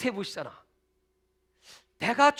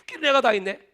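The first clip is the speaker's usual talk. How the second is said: ordinary talk, nothing marked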